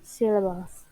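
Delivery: usual speaking voice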